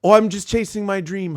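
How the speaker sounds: high, loud put-on innocence; mock sheepish husband. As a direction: act caught out and cover it brightly. Caught by wife, sheepish excuse.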